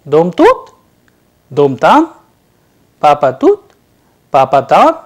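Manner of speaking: loud drill questions, pitch leaping on the last word's stressed vowel; question intonation. Shown as a demonstration. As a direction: ask each short phrase with a sharp rise on "tut", "tam" or the "do" of "doma". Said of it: "they are in interrogative".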